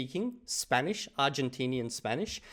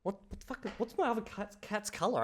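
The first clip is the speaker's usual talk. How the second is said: startled mutter; 5 semitones higher, 7 dB quieter than usual. Real, not acted. Forgets cat's colour mid-test.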